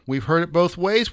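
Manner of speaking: ordinary talk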